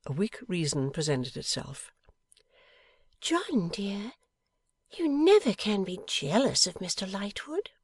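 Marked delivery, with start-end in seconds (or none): 3.2-7.7 put-on high-pitched young wife's voice; pouting, astonished wife. A narrator's reading. Wife asks if husband can be jealous, pouting astonishment. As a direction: sound amazed, with a little pout. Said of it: "in astonishment and pouting a little".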